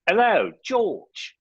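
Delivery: very slow, high mock Cockney call; Cockney accent. Caller turning up on George's Zoom, high drawn-out Cockney accent. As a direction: stretch it out, loud squeaky Cockney. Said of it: "in a Cockney accent".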